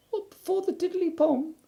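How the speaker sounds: very high, small squeaky voice; tiny piglet talking up to his friend; Piglet's squeak. Acted. Piglet correcting Pooh, tiny squeaky voice.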